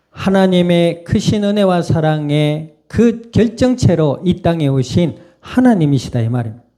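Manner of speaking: usual speaking voice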